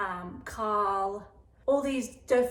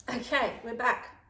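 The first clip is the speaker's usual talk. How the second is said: ordinary delivery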